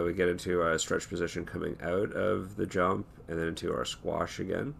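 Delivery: ordinary talk, nothing marked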